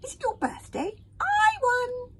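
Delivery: very high, loud giggly squeals; hogfly proud of its pop; squealing. Acted. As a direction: squeal in triumph. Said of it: "squealed the hogfly".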